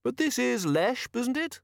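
small tinny speaker, very high; tinny, polite. A narrator's reading. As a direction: ask courteously in a tinny high voice. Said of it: "in a tinny yet polite voice".

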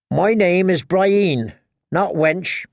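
high, slow, stubborn woman's voice; dogged Brienne. A narrator's reading. Captive's guard corrects his name for her, doggedly. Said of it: "dogged as a hound".

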